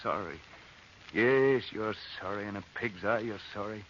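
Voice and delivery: low, soft, bitter grumble to himself; bitter muttering. Acted. Man alone on a street corner mocking his friend, bitter mutter. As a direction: spit the words quietly in contempt. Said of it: "was you talking to me".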